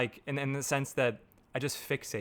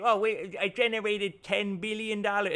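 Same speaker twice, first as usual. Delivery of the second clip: higher, hammed-up boastful guru voice; mock bragging guru; 6 semitones higher than usual. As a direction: parody a marketer bragging about billions. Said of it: "I'm not gonna say".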